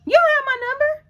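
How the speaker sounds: sudden high-pitched disbelief; shocked disbelief. Retold or imitated. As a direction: hit her with the realization. Carrie realizing he lacks number.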